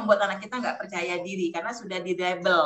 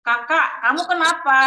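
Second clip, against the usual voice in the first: higher, louder exasperated demand; mock parental scolding; 5 semitones higher, 5 dB louder than usual. Parent demanding an answer from elder child, mock scolding.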